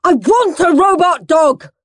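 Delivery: put-on high, stubborn child's screech; high-pitched tantrum voice; screeched demand. A narrator's reading. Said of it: "screeched Henry".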